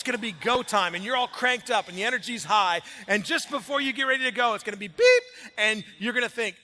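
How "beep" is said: loud electronic beep; imitated beep. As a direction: hit it like a sudden alert. Phone alarm sound imitated.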